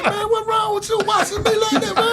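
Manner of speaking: high, laughing mock shout; laughing mock protest. Quoting himself at the strip club, laughing high protest.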